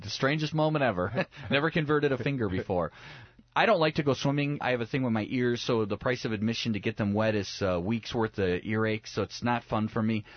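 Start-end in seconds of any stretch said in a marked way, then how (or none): none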